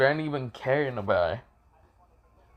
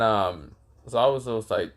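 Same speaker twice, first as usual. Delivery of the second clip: ordinary talk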